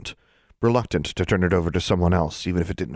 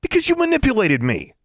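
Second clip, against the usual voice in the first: angry; 5 dB louder than usual. A narrator's reading. Angry girl confronts grannies.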